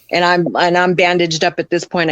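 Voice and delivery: ordinary talk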